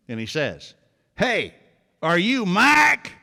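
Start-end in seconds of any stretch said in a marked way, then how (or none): 1.2-3.1 a slow, high, stretched-out West Texas drawl on "Mike"; friendly West Texas twang, stretched slow. Retold or imitated. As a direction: draw it out like a West Texas local. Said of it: "That's how they say it in West Texas".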